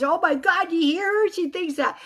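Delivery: high, loud jeering; mocking crowd imitation. Twenty guys at work ridiculing her, mocking. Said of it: "They started mocking me".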